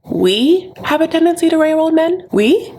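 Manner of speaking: high, rising disbelief; incredulous echo. Acted. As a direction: throw it back as an outraged question. Incredulous echo of Lisa's claim.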